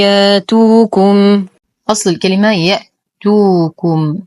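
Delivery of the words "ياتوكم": ياتوكم recited slowly with the hamza turned to a long ا; Warsh recitation. Shown as a demonstration. Teacher recites in Warsh reading for students, slow chant. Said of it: "الإمام ورش يقوم بإبدال كل همز ساكن".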